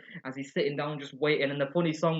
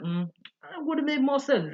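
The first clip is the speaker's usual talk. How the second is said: Lisa voice; 11 semitones higher, slower than usual. Retold or imitated. Lisa's dry remark retold.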